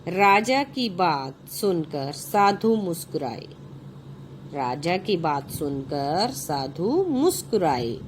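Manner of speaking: put-on slow, clear reading for children; story narration. A narrator's reading. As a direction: read each phrase out slowly to the class. Reads the story aloud. (बच्चों के लिए कहानी पढ़ना)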